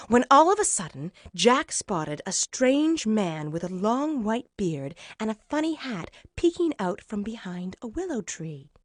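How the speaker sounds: plain reading voice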